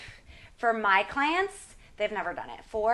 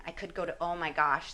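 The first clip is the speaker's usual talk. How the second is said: ordinary talk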